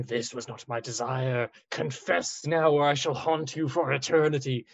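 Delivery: spook them like a ghost; ghostly voice. Pretend. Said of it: "I'll throw my voice and have him".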